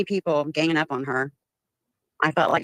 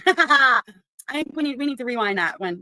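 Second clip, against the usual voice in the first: laughing; 6 semitones higher than usual. Real, not acted. Laughing gloat at clip.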